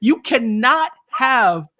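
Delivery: high, loud, slowed cry of alarm; alarmed outburst. Retold or imitated. Host recalling his own panic, high and loud alarm. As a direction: blurt it out as if the penny just dropped. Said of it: "And I'm like".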